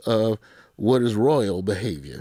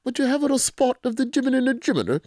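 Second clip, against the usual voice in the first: a much higher, hammed-up Cockney accent; mock Cockney; 14 semitones higher than usual. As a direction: play a silly Cockney Brit for laughs. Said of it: "Cockney makes me laugh".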